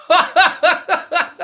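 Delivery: a big, high laugh bursting out; high burst of laughter. Real, not acted. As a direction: laugh hard at your own punchline. Punchline of his own story.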